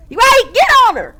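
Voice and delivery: high, loud hype; hyped cheering. Real, not acted. Streamer cheering Saturday on against Allison, hyped. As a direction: egg her on.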